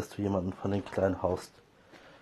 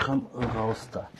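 Komm raus da drawn out slowly, each word weighted; firm and slow; slower than usual. Real, not acted. Owner repeating her order to the cat, slow and firm. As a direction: spell it out slowly like a final warning. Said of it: "hab ich gesagt".

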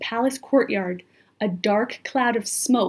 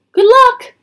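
high, loud parting call; crying out; 13 semitones higher, 12 dB louder than usual. A narrator's reading. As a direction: call a hurried farewell as you flee. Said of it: "cried Annie".

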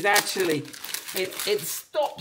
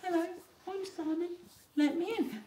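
high, small, soft voice; little high-pitched gadget voice; talking key; 10 semitones higher, 6 dB quieter than usual. Pretend. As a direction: play a tiny key calling to its car. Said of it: "it's still going".

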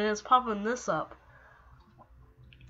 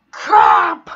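loud frustrated yell; angry shout; 6 semitones higher, 13 dB louder than usual. Real, not acted. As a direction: blurt it out furious. Angry outburst at the game.

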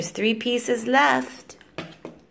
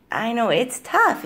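ordinary talk

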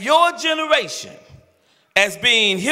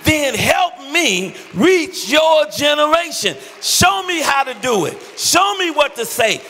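loud, higher, fervent sermon climax, half-sung; full-force preaching; 5 semitones higher, 4 dB louder than usual. Acted. Pastor begging millennials to help reach their friends, loud fervent preaching. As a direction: whoop it up like a preacher at the peak. Pastor's passionate altar appeal.

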